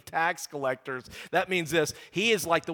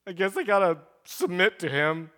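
mock sorrowful; 2 semitones higher than usual. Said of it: "He is not sorrowfully going".

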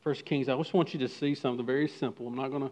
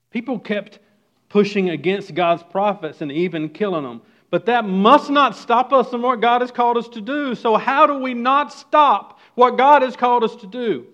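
loud, high impassioned preaching; preaching at full force; 8 semitones higher, 8 dB louder than usual. Acted. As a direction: drive the point home to the congregation.

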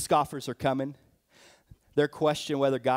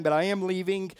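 ordinary talk, nothing marked

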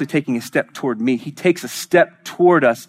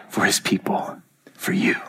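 low, intimate preacher's whisper; hushed whisper; 5 semitones lower, 4 dB quieter than usual. Real, not acted. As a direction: drop to a whisper for the listeners. Hushed climax after God roars.

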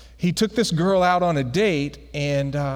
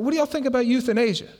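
high, quick repeated question; imitating a forward friend, high and fast; Skin; 6 semitones higher, faster than usual. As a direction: rattle it off, pitched up. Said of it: "He's like".

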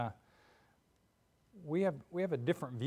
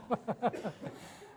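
laughing; 8 semitones higher, 5 dB quieter than usual. Real, not acted. Laughs after his impression.

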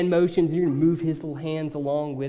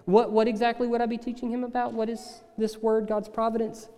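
higher, fast, eager voice of a listener who thinks he knows; mock-casual imagined listener, higher and quick; breezy know-it-all listener; 6 semitones higher, faster than usual. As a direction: rattle it off, then trip into a confused question. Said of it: "you might be like".

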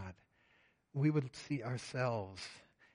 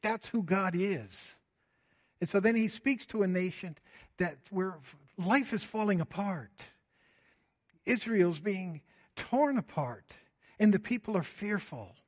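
raised, driving sermon voice; full-force preaching; 5 semitones higher, 4 dB louder than usual. Acted. Pastor preaching to his church, raised and driving. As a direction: press the question on the congregation.

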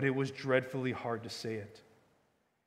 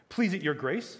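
higher-pitched formal address; cold politeness; 5 semitones higher, 4 dB louder than usual. A narrator's reading. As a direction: address her with icy courtesy. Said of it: "very coldly and politely".

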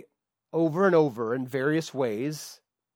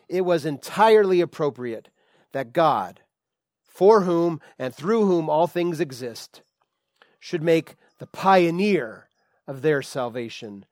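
slow, louder formal scripture read; 5 dB louder than usual. Acted. Preacher reading the verse aloud to the church, solemn and emphatic. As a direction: lift the verse out for the congregation.

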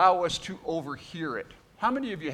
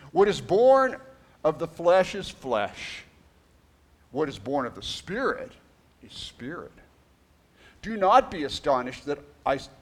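scripture reading; 3 semitones higher, 4 dB louder than usual. Acted. Reads scripture aloud.

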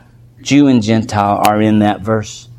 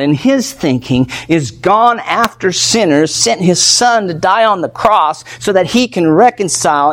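higher, rising preacher's fervour; fervent preaching; 6 semitones higher than usual. Acted. Pastor stirring the congregation about reconciliation, fervent preaching. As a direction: preach it with growing passion. Sermon builds to fervent peak.

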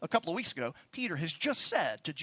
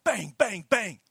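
knocking imitation; 5 semitones higher, 9 dB louder, slower than usual. Mimics SS knocking on door.

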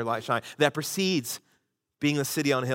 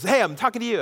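higher, pushy mock call from a tipsy stranger; insistent call; 7 semitones higher, faster than usual. Tipsy woman insists the pastor answer her, pushy call. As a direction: demand he turn around and answer. Drunk woman demanding his attention.

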